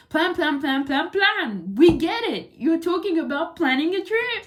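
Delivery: loud teasing, impatient complaint; mock exasperation. Shown as a demonstration. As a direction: roll your eyes at the repetition. Mocking repetitive text.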